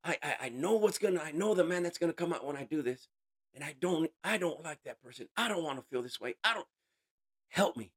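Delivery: high, pleading, tearful plea to God; tearful prayer. Retold or imitated. On a rock, begging the Lord for help, tearful and pleading. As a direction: beg for help through tears. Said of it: "just crying and crying".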